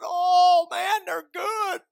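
high, drawn-out mock whine; acted craving. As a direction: protest longingly, stretching it out high. Acting his protest at giving up cigarettes.

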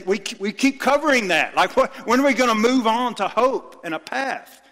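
a preacher getting worked up at his peers; fired-up frustrated retort. Retold or imitated. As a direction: demand an answer, fed up. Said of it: "And I say".

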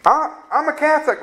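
mimicking a man arguing at a bus station. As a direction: brush off the preacher, a bit pleased with himself. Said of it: "He said".

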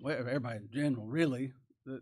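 normal talking voice

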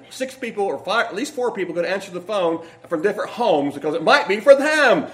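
usual speaking voice

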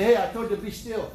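father yelling at his son through the door; father scolding. Retold or imitated. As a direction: scold the boy for moving again.

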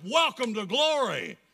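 loud, high proclamation; exultant shout. Real, not acted. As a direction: shout the welcome out to everyone. Exultant climax of the recitation.

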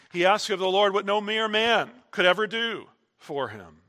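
usual speaking voice

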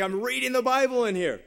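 irritated yell. Retold or imitated. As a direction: yell it toward the next room. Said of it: "I yell at her".

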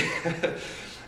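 breathy laugh. Real, not acted. Laugh after question.

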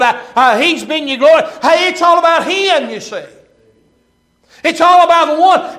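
high, loud, insistent sermon cadence; fervent preaching. Real, not acted. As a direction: drive each phrase home from the pulpit. Preaching builds to full force.

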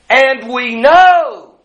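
"And we know" belted high, KNOW held long; stress on AND WE KNOW. Shown as a demonstration. Preacher correcting the misquoted verse, forceful stress on "And we know". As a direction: cry out "know" as the missing key. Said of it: "But that's not the verse. It begins".

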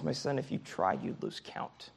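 soft and tender, almost whispered; a loving father quietly correcting his son; hushed, fading to a breath on the last words; gentle fatherly voice. Retold or imitated. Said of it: "a loving correction ... how gentle".

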